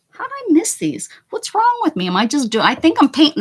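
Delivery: high, loud, frustrated cry; high exasperated outburst. Real, not acted. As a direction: squeal it in dismay. Frustrated at missing spots.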